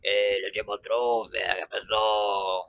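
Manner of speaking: put-on low, forced croak of a gravelly street kid; Chaveco's hoarse voice. Fan imitating Chaveco's forced hoarse voice for viewers, low and throaty. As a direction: push it from the throat like the old Chaveco. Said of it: "Ele fazia".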